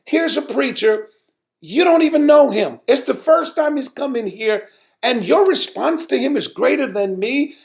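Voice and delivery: high-pitched wounded reproach to the congregation; hurt indignation. Retold or imitated. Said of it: "I got emotional about it".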